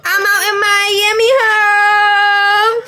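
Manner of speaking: loud, high party yell; hype shout. Real, not acted. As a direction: shout it out like a club chant.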